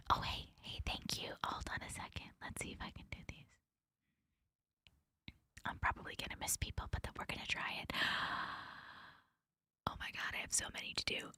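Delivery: hushed, breath-only whisper. Real, not acted. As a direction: whisper it, barely audible. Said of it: "Why am I whispering?".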